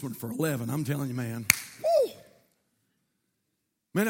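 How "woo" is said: high loud whoop; joyful whoop. Real, not acted. Preacher fired up by the Holy Ghost, loud joyful whoop. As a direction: let out a spirited woo.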